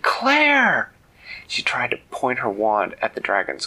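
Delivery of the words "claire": high, loud shout of a name; alarmed cry. Acted. Manella calls to Claire as she is grabbed, alarmed shout. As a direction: cry out for a snatched friend. Friend grabbed by dragon's claw.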